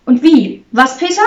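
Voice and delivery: high, excited questioning; gleeful cheer. A narrator's reading. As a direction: ask eagerly with rising pitch. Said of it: "jauchzte Barbara".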